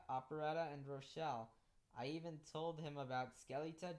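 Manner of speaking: ordinary delivery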